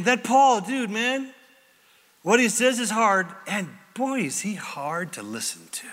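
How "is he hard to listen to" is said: whisper. Real, not acted. Aside in a whisper.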